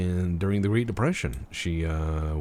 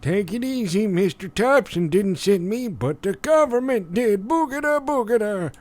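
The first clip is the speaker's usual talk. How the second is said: put-on old man voice; 12 semitones higher, 5 dB louder than usual. Same old man voice continues.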